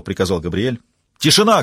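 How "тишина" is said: loud sharp bark, pitched high; barked command. Acted. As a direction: bark it to silence the crowd. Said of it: "гаркнул оператор".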